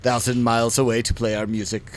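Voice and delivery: a mildly imitated Swedish singer, quiet and let down; Swedish accent. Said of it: "the guy's like in his accent is like".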